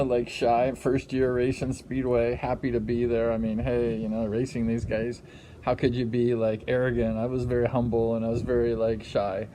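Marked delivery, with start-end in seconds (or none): none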